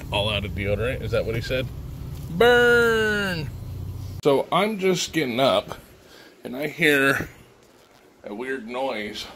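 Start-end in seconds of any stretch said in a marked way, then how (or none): 2.3-3.5 mocking shout. Mock taunt after joke.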